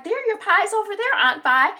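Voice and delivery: high-pitched helpful voice; high, oblivious cheerfulness; cheery helper. Retold or imitated. Someone cheerfully pointing out pies.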